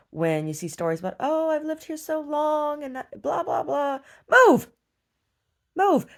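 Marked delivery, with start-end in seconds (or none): 1.2-4.0 high, slow sing-song mockery; simpering mock voice; mocking whiner. Mocking people who won't move. 4.3-6.1 high, loud barked order; exasperated shout; shouted command. Real, not acted. Yelling at them to move.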